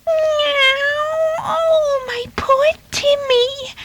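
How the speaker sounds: very high, drawn-out wailing meow; high-pitched caterwauling lament; wailing cat. Acted. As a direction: wail like a distraught mother cat. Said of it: "wailed Farmyard Cat".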